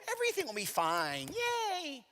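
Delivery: high, slow, sing-song fake optimism; mock perky cheer. Mocking his own happy thoughts on the walk, high sing-song. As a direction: chirp it like a cheerleader who doesn't believe it. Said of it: "these happy, perky little".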